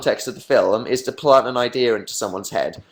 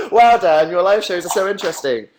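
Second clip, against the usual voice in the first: laughing sarcastic wow; mock-impressed, mocking his own show; 6 semitones higher, 4 dB louder than usual. Real, not acted. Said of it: "sarcasm at himself".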